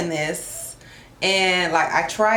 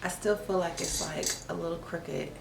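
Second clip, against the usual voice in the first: quiet aside; 12 dB quieter than usual. Real, not acted. Adjusting camera quietly, off mic.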